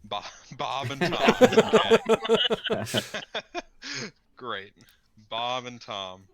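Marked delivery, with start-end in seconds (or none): 0.0-2.9 slow, loud laugh through the names; cracking up at the silly names. Real, not acted. Player laughing as he repeats the NPC names.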